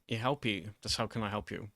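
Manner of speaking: ordinary talk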